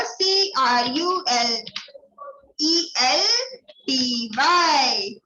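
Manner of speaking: letters spelled out very slowly; slow, drawn-out dictation; slow spelling. Shown as a demonstration. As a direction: dictate each letter slowly for students to write down. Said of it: "spelling dictation".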